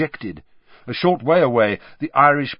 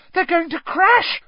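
very high, loud, alarmed shriek; 5 dB louder than usual. A narrator's reading. Hermione seeing the seekers hurtle down, shrieking. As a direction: shriek out a warning. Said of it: "shrieked Hermione".

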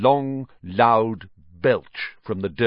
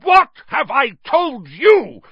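furious high shout, drawn out; enraged, slow bellow; thundering; 9 dB louder than usual. A narrator's reading. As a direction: thunder it at the boy. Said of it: "thundered his uncle".

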